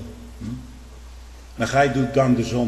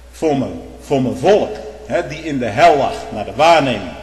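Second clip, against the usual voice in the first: voice of Christ judging; 6 dB louder than usual. Acted. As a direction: speak as the judge condemning. Voicing Christ's judgment.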